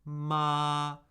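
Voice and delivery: "ma" held high, flat and unchanging; steady, level "ma"; first tone. Shown as a demonstration. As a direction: hold "ma" on one level high note. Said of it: "high, flat".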